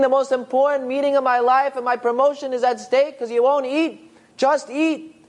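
put-on high, harsh scolding of an old man; angry yelling at father. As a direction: berate him, pitch way up, fast in the middle. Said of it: "He storms over to his father".

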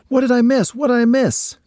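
high, eager repeated question; eager cry. Acted. Ryan bursting into a room of relatives, high eager cry. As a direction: burst in wanting to know. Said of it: "cried Ryan".

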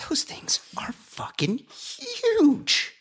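high, breathy, drawn-out awe; slow, high, hushed wonder at sheer size; awed emphasis. Real, not acted. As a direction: stretch it out in whispery amazement. Awed by polar bear size.

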